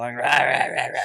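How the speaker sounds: imitated rapid growly gibberish; Tasmanian devil gabble. Fast talker mocking own speech on holiday, Tasmanian devil gabble. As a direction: rattle off cartoon-monster noises. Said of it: "they call me the Tasmanian devil".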